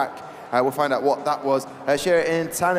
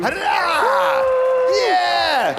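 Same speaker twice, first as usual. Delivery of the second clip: hooray cheer; 6 dB louder, much slower than usual. Real, not acted. Cheer after birthday song.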